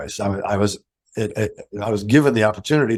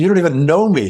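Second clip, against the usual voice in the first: astonished; 8 semitones higher, 6 dB louder, faster than usual. Retold or imitated. Retold astonished reply to offer.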